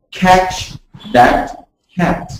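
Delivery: "catch that cat" slow with a light K; slow k sound. Shown as a demonstration. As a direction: draw out each K in "catch that cat". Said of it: "C would be a C as in cat".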